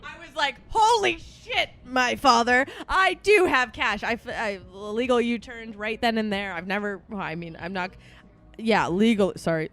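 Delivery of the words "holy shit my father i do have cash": high, loud, slow realization; surprised. Retold or imitated. Caught out by her dad, shocked exclamation. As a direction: blurt it out in shock. Realizing she has cash.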